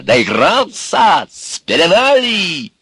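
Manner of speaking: громко, высоко и очень протяжно; прикрикнуть, растягивая каждое слово; сердитый крик. A narrator's reading. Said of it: "закричал он на Ивана". (гневно закричать)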